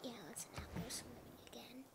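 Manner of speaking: whispering. Real, not acted. Whispered joke aside.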